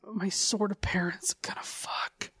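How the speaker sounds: creepy whisper. Retold or imitated. Voicing the voyeur kid watching his parents, whispered. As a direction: breathe it like a secret. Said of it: "He's like".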